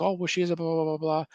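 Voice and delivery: high mock voice brushing the question off; mocking the owners. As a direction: imitate the owners' dismissive blah blah in a high voice. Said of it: "They're like".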